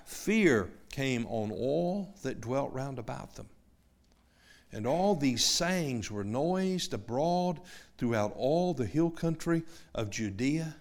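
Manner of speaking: slow, soft, solemn Bible reading; scripture reading. Acted. Preacher reading Luke to the congregation, slow and soft. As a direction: read it slowly and softly to the listeners.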